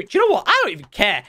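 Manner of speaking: usual speaking voice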